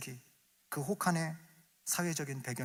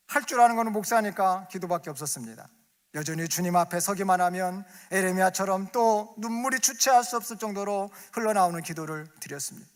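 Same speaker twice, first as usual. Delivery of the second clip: fervent preaching; 4 semitones higher, 7 dB louder than usual. Acted.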